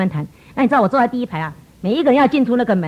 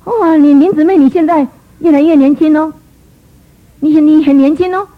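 gushing flattery; 4 semitones higher, 4 dB louder than usual. Retold or imitated. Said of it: "牧师们的聚会".

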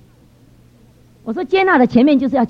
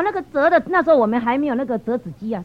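ordinary talk, nothing marked

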